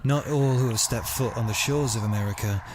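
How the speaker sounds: hushed documentary voice opening a murder story; dramatic podcast intro. Acted. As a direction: read it like a true-crime trailer. Dramatic true-crime intro read.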